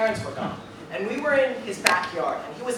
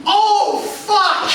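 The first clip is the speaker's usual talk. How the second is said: much higher, loud laughing yell; shout; 13 semitones higher, 12 dB louder than usual. Retold or imitated. Narrator's shocked reaction.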